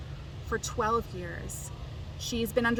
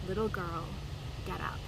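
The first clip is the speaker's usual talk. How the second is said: softer, tender and low; gentle words to a little girl; gently; 3 semitones lower, 7 dB quieter than usual. A narrator's reading. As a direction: coax a sleeping child awake. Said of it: "and he says gently".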